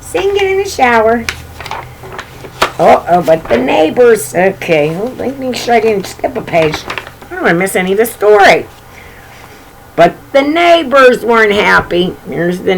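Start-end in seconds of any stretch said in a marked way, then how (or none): none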